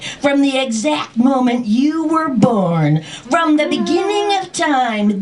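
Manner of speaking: high, insistent list, climbing steadily; mounting accusation. Retold or imitated. Dragon accusing parents of plotting, high building rant. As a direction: pile up the charges in comic indignation.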